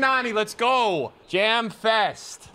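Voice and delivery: upbeat celebratory shout; excited cheer. Real, not acted. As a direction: cheer it out, pumped. Celebrating in FIFA.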